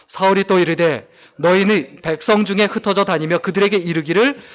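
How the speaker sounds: loud, measured reading of scripture together; unison scripture reading. Acted. Pastor leads church in reading the verse, loud and steady. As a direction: read the verse aloud with the congregation. Congregation reads verse aloud.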